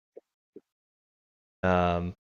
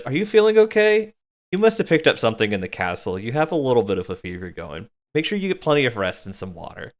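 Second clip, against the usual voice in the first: high, fast fussing concern; mock-caring big sister; annoyingly motherly; 3 semitones higher, faster than usual. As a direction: fuss over her like a know-it-all mother hen. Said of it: "in an air that would be familiar to Naz in the most annoying of ways".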